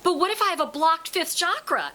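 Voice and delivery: high, loud horrified protest. Retold or imitated. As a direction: imitate a panicked student's alarm. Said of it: "she was horrified".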